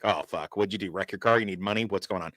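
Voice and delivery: anxious dad muttering questions; worried dad. Retold or imitated. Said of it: "I'm like".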